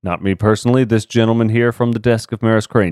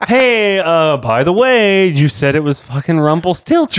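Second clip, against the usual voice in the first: mock exasperation; 10 semitones higher, 3 dB louder than usual. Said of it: "I was like".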